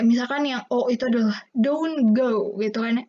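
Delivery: ordinary talk, nothing marked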